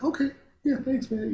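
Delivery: high-pitched, lighter quote of the mentor; imitated mild reply. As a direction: drop the yell, keep the pitch up. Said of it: "after that he was like".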